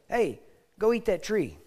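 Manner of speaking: mock a blatant, obvious temptation; mock blunt tempter.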